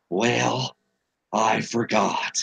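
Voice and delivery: slow, low, lightly voiced old Reagan; a half-hearted Reagan drawl; Reagan impression. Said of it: "the only real impersonation I ever do was Ronald Reagan".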